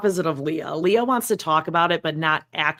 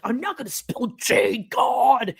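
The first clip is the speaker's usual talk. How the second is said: mock irritation; 7 semitones higher, slower than usual. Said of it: "And she's like".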